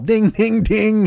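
high, sing-out ringing noise; imitated phone ring.

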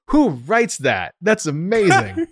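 high, drawn-out astonishment; amazed disbelief. Retold or imitated. Man retelling his reaction to a letter, amazed. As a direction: marvel slowly, pitched well up.

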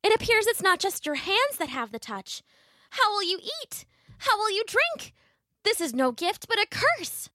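high-pitched daughter, reproachful; Marigold. Acted.

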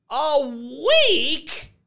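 high, shocked squawk of disbelief; high, outraged question; aghast. A narrator's reading. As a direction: echo it back, appalled. Lady aghast at the delay.

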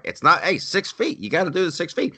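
high, insistent complaint; retold scolding. Traveler to wheelchair pusher at the airport, scolding. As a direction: pipe up to scold someone crowding you.

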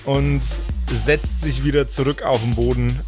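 ordinary talk, nothing marked

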